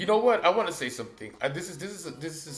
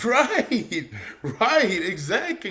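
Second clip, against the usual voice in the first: amused, higher-pitched laughing assent; laughing agreement; 5 semitones higher, 3 dB louder, much slower than usual. Real, not acted. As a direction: agree through laughter, drawn out.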